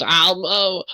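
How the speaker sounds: a hint of an embarrassed host; flustered old man. Old man asking the party to wait a moment, flustered. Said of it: "he steps and he goes".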